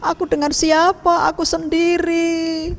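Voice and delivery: high, tearful lament with a sob in the voice; crying widow. Acted. Grieving widow cries to herself over her dead son. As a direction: wail that you'll be alone in old age. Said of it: "Dia menangis, dia sedih".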